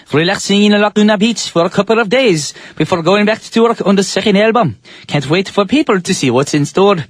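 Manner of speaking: put-on mixed-up Irish Scottish British accent. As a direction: read the quote in a mock mix of Irish and Scottish accents. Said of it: "I know that was a very mixed up accent of, like, Irish, Scottish, and British".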